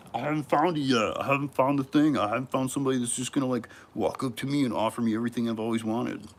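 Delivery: loud, high, whiny complaint; wailing mock self-pity, high and loud; mocking whine. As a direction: mimic a whining viewer, hammed up. Mimics the viewer whining.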